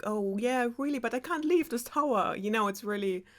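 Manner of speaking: mild imitation of a girl explaining; reluctant Rapunzel. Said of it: "of course, is like".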